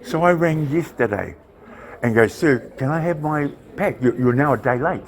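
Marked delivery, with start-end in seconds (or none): none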